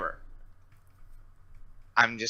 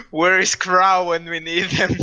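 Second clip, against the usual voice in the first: high, loud mock giant voice; dopey cartoon bellow; 6 semitones higher, 5 dB louder than usual. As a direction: whine it like a worried giant. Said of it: "mimic his voice".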